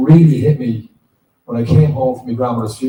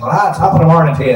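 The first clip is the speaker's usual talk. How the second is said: fast, hammed-up stage Irish accent, a touch louder; mock a Yank's fake Irish accent; 4 semitones higher, 5 dB louder, faster than usual. Mocking Yanks playing Irish parts, hammed-up stage Irish accent. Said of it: "Yanks taking Irish parts".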